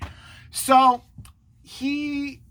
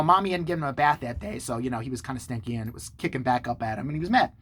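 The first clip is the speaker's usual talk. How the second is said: ordinary delivery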